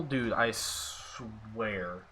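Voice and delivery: very slow, low and quiet disbelief; sinking, drawn-out exasperation; exasperated. Real, not acted. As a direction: drag the words out in weary frustration. Reacts to another possible fumble.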